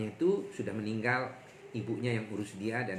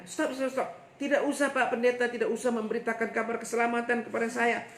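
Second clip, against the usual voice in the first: a higher, louder exasperated brushing off; annoyed bank director; 12 semitones higher, 4 dB louder than usual. Retold or imitated. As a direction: cut the pastor off, irritated and dismissive. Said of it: "direktur bank ini stop langsung omongannya".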